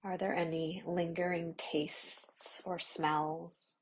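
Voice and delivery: ordinary talk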